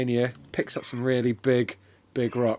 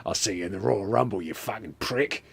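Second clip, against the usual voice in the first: low, quick, quieter threat; lightly voiced wrestler, pitched down; wrestler's parting threat; 6 semitones lower, 6 dB quieter than usual. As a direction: toss it off low and fast.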